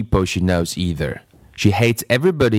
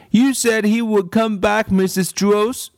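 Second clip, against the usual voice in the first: put-on high, insistent little-girl voice; a stubborn girl contradicting her teacher; bratty child insisting she's right; contrary girl; 10 semitones higher than usual.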